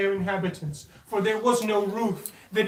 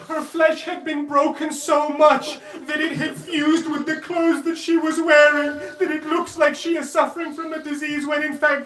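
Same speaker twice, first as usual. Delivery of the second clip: louder and higher, building outrage; impassioned preaching; 4 semitones higher, 5 dB louder than usual. Acted. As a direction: preach it at full force, raising the voice.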